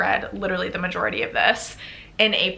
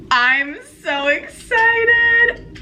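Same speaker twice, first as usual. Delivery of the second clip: very slow, high-pitched squeal; real excitement; 12 semitones higher, 4 dB louder, much slower than usual. Real, not acted. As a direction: stretch every word into a thrilled squeal.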